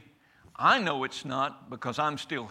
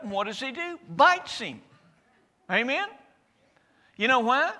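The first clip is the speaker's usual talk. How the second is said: fiery preaching; 8 semitones higher, 4 dB louder than usual. Acted. Preacher building to full force.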